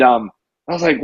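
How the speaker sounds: ordinary talk, nothing marked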